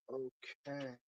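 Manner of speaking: a soft, subdued okay; very quiet. Retold or imitated. Tired coworker answering quietly after cleaning up. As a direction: say it quietly, barely there. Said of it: "She's, like, very quiet".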